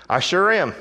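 loud, high, crowing victory call; mock triumph. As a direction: ring it out like a game-show win. Acts out crowing over winning exchange.

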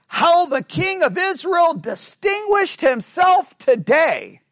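high, slow mock acclaim; sarcastic. Teacher reading the Second Samuel taunt of the king, sarcastic. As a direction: draw out the praise with heavy irony. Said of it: "Or in Second Samuel".

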